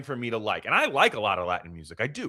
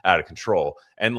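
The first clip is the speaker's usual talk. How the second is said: usual speaking voice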